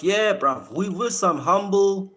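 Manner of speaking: ordinary delivery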